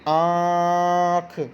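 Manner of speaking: आँख drawn out very slowly, the long आँ through mouth and nose; nasal vowel demo. Shown as a demonstration. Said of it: "आवाज़ हमारे मुंह से और नाक से, दोनों से आएगी".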